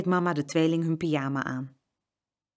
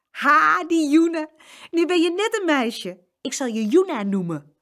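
much higher, louder mocking call; teasing call; 10 semitones higher, 5 dB louder than usual. Saskia teases Jeroen in girls' clothes, high taunt. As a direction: get your own back, taunting. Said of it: "riep Saskia".

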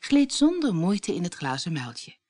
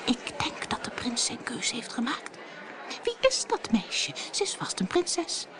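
breathy gossiping whisper, then higher; a ballroom whisper, higher and excited; whispering behind fans; 6 semitones higher, 4 dB quieter than usual. A narrator's reading. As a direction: gossip in a hush behind a fan. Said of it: "fluisterden achter hun waaiers".

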